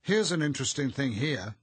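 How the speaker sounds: loud, high, determinedly chatty; valiantly entertaining. A narrator's reading. Arthur reading aloud to the doctor, valiantly entertaining. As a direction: try hard to keep the company entertained. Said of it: "in the manner of one endeavouring valiantly to entertain".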